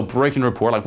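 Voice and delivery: ordinary talk, nothing marked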